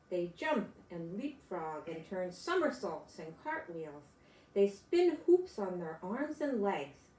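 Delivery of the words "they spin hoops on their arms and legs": plain reading voice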